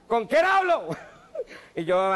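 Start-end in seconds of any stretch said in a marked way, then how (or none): 0.1-0.9 higher, louder put-on president voice; mock president on the phone. Comedian playing the president calling him, mock phone voice. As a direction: ham up the caller from the top.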